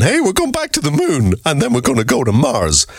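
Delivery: high, hammed-up boastful announcement; mock the bragging officials. Mocking officials boasting about space.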